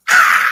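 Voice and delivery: loud, breathy mock scream of a thrilled fan; fanning-out scream. Fan meeting his idol on the inside, mock scream. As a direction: let out an inner fan squeal, acted out. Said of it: "I'm fanning out".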